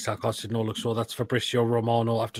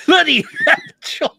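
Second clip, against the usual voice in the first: shocked shriek; 11 dB louder than usual. Real, not acted. Shocked by a picture of food.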